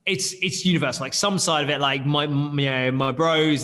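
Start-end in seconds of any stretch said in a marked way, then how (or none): none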